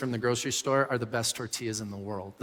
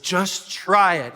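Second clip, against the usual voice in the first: slow, pleading nudge; drawn-out coaxing, mock patient; coaxing plea; 4 semitones higher, 6 dB louder, much slower than usual. A husband coaxing his wife to taste the tortilla, slow and pleading.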